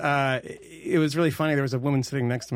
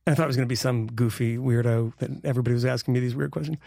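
usual speaking voice

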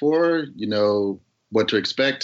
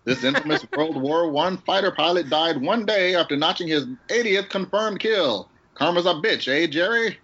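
high-pitched vintage announcer; hammed-up old-time announcer delivery; 6 semitones higher than usual. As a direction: read it like an old newsreel host. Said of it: "my old time announcer voice".

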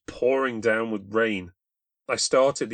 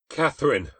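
loud, raised call; calling out again; 5 semitones higher, 6 dB louder than usual. A narrator's reading. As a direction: call her name, closer now. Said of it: "I said again".

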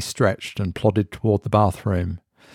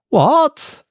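a very high, louder shocked what; 4 dB louder than usual. A narrator's reading. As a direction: caught completely off guard. Mark shocked at device's warning.

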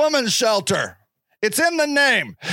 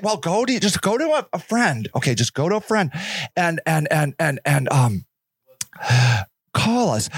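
patronizing clerk voice; 6 semitones lower than usual. Retold or imitated. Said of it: "shelter worker voice".

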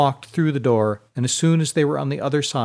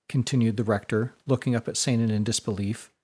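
ordinary delivery, nothing marked

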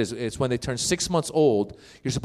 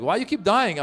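mom's voice; 7 semitones higher than usual. Retold or imitated. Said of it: "my mother was like".

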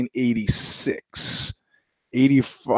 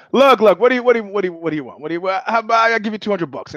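flustered label guy; 5 semitones higher, much faster than usual. Retold or imitated. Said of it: "And the guy goes".

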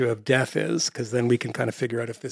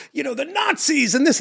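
high-pitched imitation of a blusterer; much higher, hammed-up bluster; mock blustering; 12 semitones higher than usual. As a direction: send up a screaming blusterer without really shouting. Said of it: "this bluster screaming and being like".

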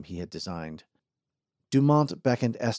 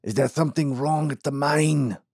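put-on old man voice; 5 semitones higher than usual.

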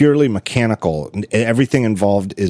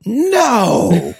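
high, loud shocked yell; 14 semitones higher, 4 dB louder than usual. Retold or imitated. Wife yelling in the kitchen over the price, loud shocked no. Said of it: "she yelled".

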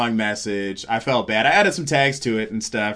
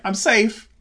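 high-pitched mock all-clear; retold relief; 10 semitones higher than usual. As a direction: pipe up high, comically relieved. Retold relief before telling joke again.

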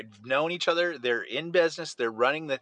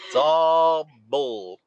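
mock dismissal; 6 dB louder, much slower than usual.

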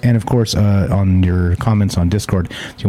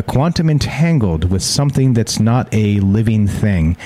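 ordinary talk

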